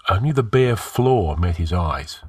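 ordinary delivery, nothing marked

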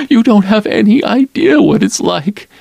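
a hint of a nervous young woman; Lila's voice.

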